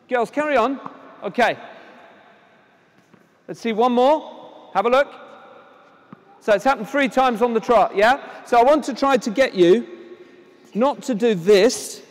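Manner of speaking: ordinary talk, nothing marked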